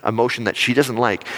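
ordinary talk

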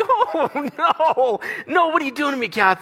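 very high, hammed-up whine through laughter; mock wailing protest. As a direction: plead with your wife in comic anguish. Acts out being overwhelmed by show.

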